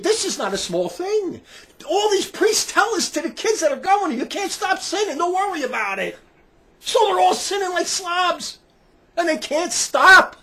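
high-pitched, drawn-out indignant shouting; loud, high, incensed preaching; outraged rant. Real, not acted. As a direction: cry out against the scandal. Ranting about priests excusing sin.

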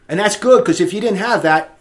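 ordinary talk

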